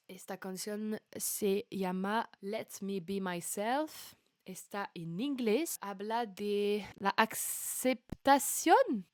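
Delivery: slow, careful Spanish with a French accent. Shown as a demonstration. Streamer trying out her Spanish accent for a viewer, slow and careful. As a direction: speak Spanish slowly, showing off your Spanish accent. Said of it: "juger de mon accent espagnol".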